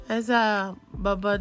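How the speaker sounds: ordinary talk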